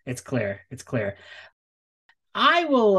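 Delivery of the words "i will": high-pitched. Acted. Host starts in high voice.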